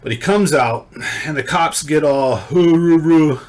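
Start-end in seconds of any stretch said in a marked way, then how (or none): 2.4-3.4 loud, higher-pitched mock cop roar; wordless angry hollering, hammed up; mock angry barking. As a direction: imitate riled-up cops with loud wordless hoots. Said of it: "they start getting all angry at him".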